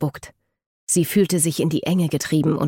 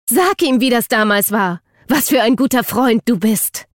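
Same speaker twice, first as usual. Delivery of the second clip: high, loud, forceful demand; angry shout; 6 semitones higher, 5 dB louder than usual. A narrator's reading. Eden demands that Luke tell Jackson, shouting angrily. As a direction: press him furiously to confess. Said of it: "rief sie".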